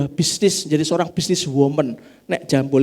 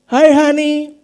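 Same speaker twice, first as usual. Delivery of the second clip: higher and louder, a sugary mock "Hi, honey"; mock English greeting; 13 semitones higher, 9 dB louder, much slower than usual. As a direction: overplay a parent showing off English. Parent tries English at home.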